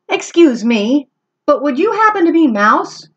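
high, polite kitten voice; polite kitten Max. Acted. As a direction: ask very politely, rising on Mouse. Max asks elephant.

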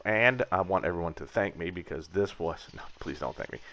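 usual speaking voice

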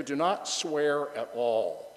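slow, stress on not; slow emphatic. Acted. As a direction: lean on not, slowly. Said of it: "But I say to you".

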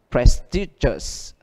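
prestigious drawn out slowly, stress on -sti-; slow stress demo. Shown as a demonstration. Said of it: "teacher models pronunciation slowly".

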